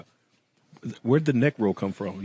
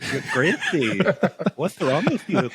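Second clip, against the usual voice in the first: mock Mexican accent; 5 semitones higher, slower than usual. Demonstrating Mexican accent to Pat.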